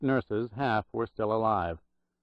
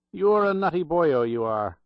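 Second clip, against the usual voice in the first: higher, louder Irish brogue teasing; 7 semitones higher, 5 dB louder than usual. Acted. Old Irish clerk teasing the student, lively Irish brogue. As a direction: rib him in a jolly Irish brogue. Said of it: "in a loud Irish brogue".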